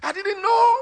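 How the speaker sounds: loud, high imitated wail; retold crying. Preacher voices the woman saying she never knew, high mock wail. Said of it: "she started crying".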